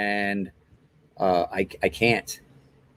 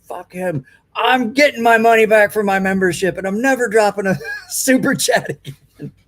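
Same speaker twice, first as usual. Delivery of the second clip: higher, indignant put-on voice; mock angry viewer; 10 semitones higher, 3 dB louder than usual. Streamer voicing a timed-out viewer storming off, higher and indignant. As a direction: huff like a wronged fan quitting. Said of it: "Beardo's like".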